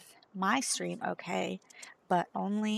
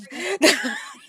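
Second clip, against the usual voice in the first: big burst of laughter; loud laugh; 9 semitones higher, 15 dB louder than usual. Real, not acted. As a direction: crack up loudly.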